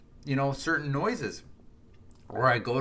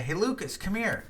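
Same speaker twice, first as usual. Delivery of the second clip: calling someone over; 7 semitones higher than usual. Retold or imitated. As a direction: lightly mimic calling a child over. Quoting people calling a child over.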